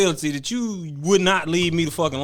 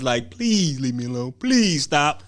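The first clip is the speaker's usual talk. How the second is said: put-on begging like a kid; slower than usual. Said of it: "begging like a little kid".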